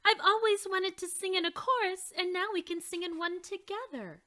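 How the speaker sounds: high excited voice; eager. Acted. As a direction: gush with delight. Dolores eager about chorus.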